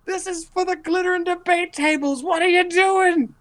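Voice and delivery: very high screeching character voice; outraged laundry woman. Acted. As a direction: scold the intruder in a piercing falsetto. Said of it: "And she's like".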